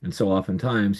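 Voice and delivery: ordinary delivery, nothing marked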